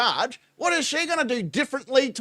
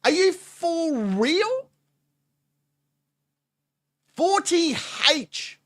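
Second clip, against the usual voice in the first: slow, high, drawn-out disbelief; amazed disbelief; 7 semitones higher, much slower than usual. Real, not acted. Reacting to a clip, slow amazed disbelief. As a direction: stretch it out in shock.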